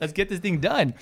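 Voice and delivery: loud, high-pitched rallying cry; retold hype. Agent cheering on a seller's listing, excited. Retold eagerness to close deal.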